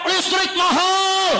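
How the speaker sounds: high, drawn-out public outcry; protesters' shout. Acted. People protest to officials over electricity, drawn-out shout. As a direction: call it out slowly like a protest chant. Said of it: "Rakyat teriak".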